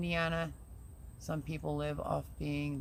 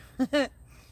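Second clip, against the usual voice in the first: high loud burst of laughter; embarrassed laugh; 9 semitones higher, 7 dB louder than usual. Real, not acted. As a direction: laugh it off after venting. Laughs after admitting venting.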